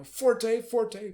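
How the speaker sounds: high, loud, emphatic "forte". Shown as a demonstration. Conductor demonstrating dynamics for drama, loud high forte. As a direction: punch it out strongly. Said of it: "So bring the drama here".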